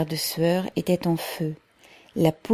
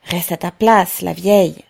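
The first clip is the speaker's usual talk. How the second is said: louder, sharp brush-off; sour rebuke; 6 dB louder than usual. A narrator's reading. Workers rebuffing the old mother in the crowd, sour and loud. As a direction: push her back, resentful. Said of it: "On lui disait avec aigreur".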